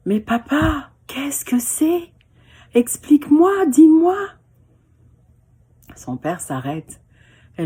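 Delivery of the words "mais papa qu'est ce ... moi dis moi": high, pleading little voice; eager child. Acted. Girl asking her father about the echo. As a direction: beg papa like a curious little girl. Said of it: "Alors elle se dit".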